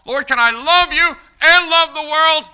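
high, very loud question to God; shouted plea. Acted. Believer asks God to share love with world, shouted plea. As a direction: cry it out at the top of the voice.

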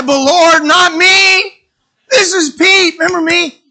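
high, loud, indignant plea; pleading protest. Acted. Peter reminding the Lord who he is, loud indignant protest.

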